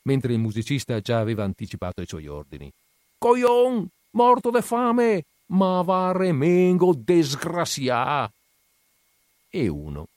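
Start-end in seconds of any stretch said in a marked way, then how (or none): 3.2-8.3 higher, louder, slow Venetian cursing; a boss shouting curses as the man leaves; furious dialect insults. A narrator's reading. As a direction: spit the insults after him.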